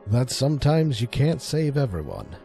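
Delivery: ordinary delivery, nothing marked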